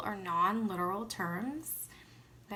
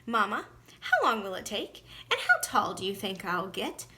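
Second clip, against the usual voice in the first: put-on louder, eager child asking fast questions; excited boy; 7 dB louder than usual. A narrator's reading. Boy pestering his mama with eager questions, excited. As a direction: let the boy bubble over with eagerness. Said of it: "Growing more excited".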